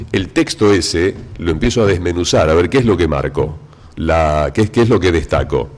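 ordinary delivery

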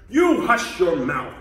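loud, slow royal rebuke; howling. Acted. Turtle king silences complaining Mac, howling. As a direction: bellow it slowly at an upstart. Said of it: "howled the mighty King Yertle".

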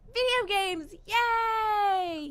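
loud, high celebration; excited cheer. Real, not acted. Celebrates choosing the child's trait.

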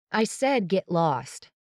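slow, high, angry warning. Acted. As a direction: bite each word out. Repeats the brush-off, wanting to punch something.

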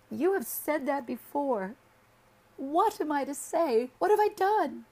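high and loud, sobbing; wife crying across the table at her husband; blubbering tears. A narrator's reading. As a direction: wail the questions through tears. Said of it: "She began to cry".

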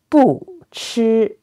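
"不" falls, then "吃" stays high and level; fourth-tone bu. Shown as a demonstration. Teacher giving a phrase to repeat, "不" falling, "吃" high. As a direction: drop "不", sustain a high flat "吃". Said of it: "bu, with the fourth tone".

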